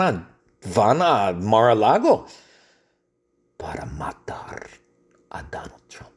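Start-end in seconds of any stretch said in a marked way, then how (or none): 3.6-6.1 hushed conspiratorial whisper. Acted. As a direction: breathe the murder plot as a secret. Whispered murder plan. (susurro de complot)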